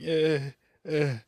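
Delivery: reluctant "eh". Acted. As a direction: waver doubtfully, unimpressed. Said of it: "you're like".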